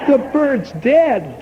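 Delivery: the owner repeating "The bird's dead?" in slow disbelief; incredulous. Acted. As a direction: echo the news as if you can't believe it. Owner hears the news in disbelief.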